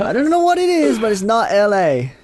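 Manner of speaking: very high mock imitation, slowing at the end; hammed-up high-pitched retelling of a friend; mimicked friend's verdict. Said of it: "Oh, let me try that".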